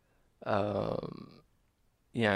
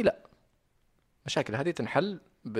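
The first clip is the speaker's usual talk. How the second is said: normal talking voice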